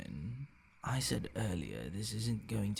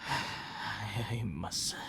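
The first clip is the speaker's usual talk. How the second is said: normal talking voice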